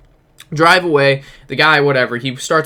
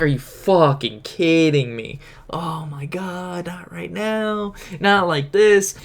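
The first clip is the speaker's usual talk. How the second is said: slow, exasperated retold groaning; drawn-out dismay; 3 semitones higher, much slower than usual. Storyteller spotting the man again at the gym, drawn-out dismay. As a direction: drag out each word in mock despair. Retelling his dismay at meeting him.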